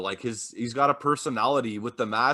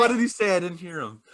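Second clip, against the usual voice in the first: higher, louder teasing repeat; mock Uno; 6 semitones higher, 3 dB louder, faster than usual. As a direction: replay it with more bite. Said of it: "It's like".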